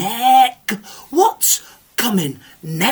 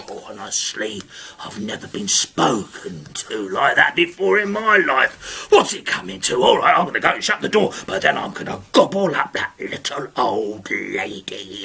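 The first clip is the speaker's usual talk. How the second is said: low, muttering offended ogre; grumbling ogre; 9 semitones lower than usual. Acted.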